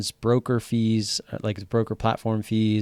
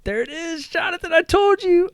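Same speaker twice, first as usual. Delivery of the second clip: high, loud triumphant I told you; excited gloating; 4 dB louder than usual. Real, not acted. Trader gloating to Jonathan about his winning pick, excited. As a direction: crow with delight at being right.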